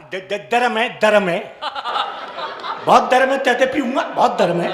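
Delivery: stammering lisp, "दरम" with d for g; lisping son. Acted. Burnt son blurting that tea is hot, lisping yelp. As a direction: yelp "दरम है" lisped, d in place of g. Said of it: "बेटा तोतला था".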